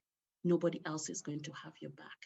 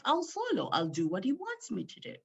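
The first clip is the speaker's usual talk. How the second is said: normal talking voice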